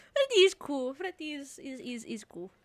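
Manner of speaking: a playful put-on accent on Freddy is cool. Streamer mimicking a character in a put-on accent. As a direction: slip into a goofy accent for the line. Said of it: "I don't know why I'm doing that accent".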